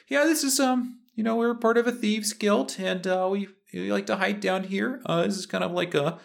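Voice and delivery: high-pitched, rambling henchman voice; thieves' guild henchman. Acted. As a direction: awkwardly explain in a high character voice.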